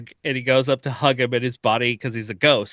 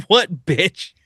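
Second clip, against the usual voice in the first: higher and louder, mock annoyed; sassy retort; 6 semitones higher, 5 dB louder than usual. Angel snapping at the demon, sassy mock retort. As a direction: toss it back with attitude.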